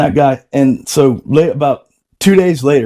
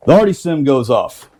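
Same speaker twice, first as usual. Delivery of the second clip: usual speaking voice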